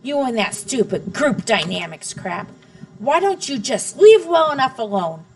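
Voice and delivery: loud, high call of the name, then ranting; angry yell. A narrator's reading. Father blaming his wife, loud and angry. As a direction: blame her at the top of his voice. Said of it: "My father stood up and yelled".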